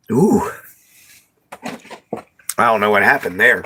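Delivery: choking a little on a bug; catching breath after a bug; just swallowed a bug. Real, not acted. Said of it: "think I swallowed a bug".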